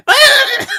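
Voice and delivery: high, loud comic shriek; mock baby wail. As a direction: let out a shrill-pitched mock wail. Baby's noise in retold comic.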